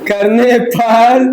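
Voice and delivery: slow, spaced-out dictation with long pauses. Shown as a demonstration. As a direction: dictate slowly for students to write. Teacher dictates a definition. (धीमे-धीमे रुक-रुक कर लिखवाना)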